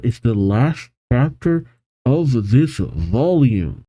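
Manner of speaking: normal talking voice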